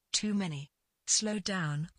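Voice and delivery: usual speaking voice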